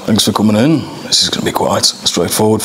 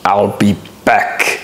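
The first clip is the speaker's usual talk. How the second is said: slow, flat robotic Terminator line; Terminator impression; slower than usual. Auditioner sneaking in a slow Terminator catchphrase. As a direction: deliver it like the cyborg, slow and deadpan. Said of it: "It was from the Terminator".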